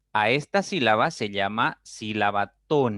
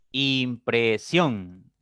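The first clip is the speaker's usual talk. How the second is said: "Impresión" drawn out very slowly as Im - pre - sión; teacher slowly sounding out "Impresión" beat by beat; syllable by syllable; much slower than usual. Shown as a demonstration. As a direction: split "Impresión" into three long syllables. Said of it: "Tiene tres sílabas".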